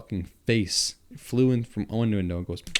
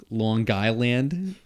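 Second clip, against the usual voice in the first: slow puzzled question, mocking the accent; puzzled strangers; 3 semitones higher, much slower than usual. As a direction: drag it out like a baffled stranger. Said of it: "They were like".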